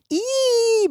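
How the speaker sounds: put-on a sudden high, loud girl's shriek. A narrator's reading. Daughter drenched with water at the table, shrieking high and loud. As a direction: squeal as cold water hits you. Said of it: "brüllte sie".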